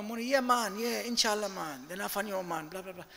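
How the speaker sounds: lightly imitated Jamaican accent; mock Jamaican accent. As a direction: imitate a hyped worker with a put-on Jamaican lilt. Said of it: "why are you speaking to me like a Jamaican".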